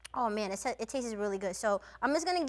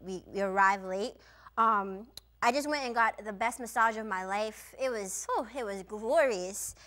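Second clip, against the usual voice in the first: ordinary delivery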